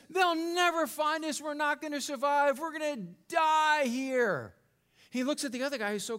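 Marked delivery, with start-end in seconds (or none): 0.1-4.5 high, slow panicky wail; high, slow terrified moan; panicked. Acted. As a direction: play the castaway sure he will die. Panicked castaway in the joke.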